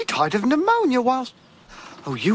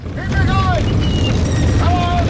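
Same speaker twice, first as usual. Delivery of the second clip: shouting; 6 semitones higher, 5 dB louder, slower than usual. Acted. Yelling at the horse team.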